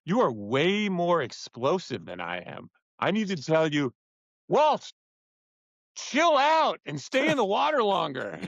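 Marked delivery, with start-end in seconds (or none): 4.5-8.4 high-pitched call to a teammate; exasperated, high mock shout; mock yelled correction. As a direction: call out an order over your shoulder, in imitation. Yelled correction to rowing partner.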